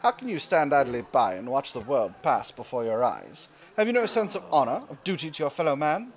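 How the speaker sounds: a hammed-up fake British accent, grandly declaimed; terrible British accent. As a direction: read the event like a pompous British orator with a bad British accent. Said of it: "doing my terrible British accent for all of my, uh, all of our event text".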